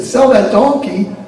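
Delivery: high, loud laughing ridicule; mocking gentlemen. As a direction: laugh at their foolishness.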